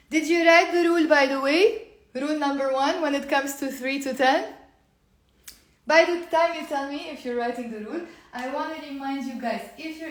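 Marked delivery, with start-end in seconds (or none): 0.1-8.0 calling out. Real, not acted. Calling out to viewers.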